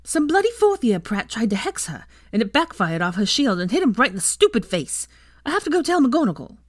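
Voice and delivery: high-pitched, indignant outburst; enraged. A narrator's reading. Ginny reports the hex attack to Draco, enraged. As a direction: blurt out the outrage, rushing at the end. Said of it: "her face still enraged".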